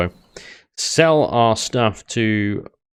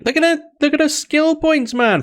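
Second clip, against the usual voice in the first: amazed. Real, not acted. Impressed by wife's skills.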